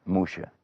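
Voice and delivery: "Musha" with a sh sound; Hebrew pronunciation. Shown as a demonstration. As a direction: say "Musha" with sh, as in shalom. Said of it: "that's his name".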